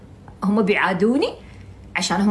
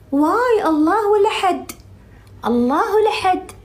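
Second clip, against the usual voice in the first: mock astonished wail; 9 semitones higher, 3 dB louder, slower than usual. Exclaiming in mock disbelief.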